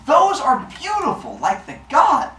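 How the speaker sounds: high-pitched gushing; exaggerated high swoon; awed admiration. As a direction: coo over it with the voice way up. Zeus admires pile in story.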